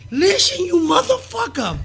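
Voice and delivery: high, drawn-out angry yell; mock an enraged promoter's high yell; angry rant. Promoter cursing out the spot-blower, furious high yell. Acting out Sparta cursing the wrestler.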